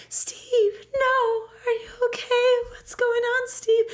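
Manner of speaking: high, distressed pleading; mock worried Claire. Claire checking on impaled Steve, mock high distress.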